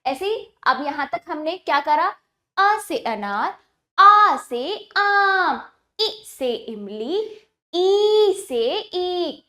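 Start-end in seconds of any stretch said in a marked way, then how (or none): 4.0-5.7 "आ से आम" with "आ" long, slow and open; stretched vowel. Shown as a demonstration. Teacher modelling the long "आ" for children. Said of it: "हमने स्वर को खींचा।". 7.7-9.4 "ई से ईख" with the "ई" held very long and slow. Shown as a demonstration. Teacher to class, drawing out the long "ई". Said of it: "हमने स्वर को खींचा।".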